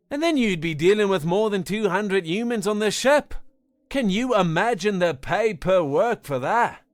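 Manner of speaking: teasing the flustered alien, mate; amused Australian. A narrator's reading.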